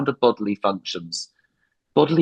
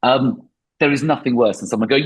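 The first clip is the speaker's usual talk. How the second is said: ordinary talk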